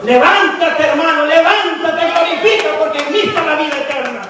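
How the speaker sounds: loud, high preacher's cry; rousing exhortation. Acted. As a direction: rouse them to get up, full voice. Preacher voices the Lord's rousing call.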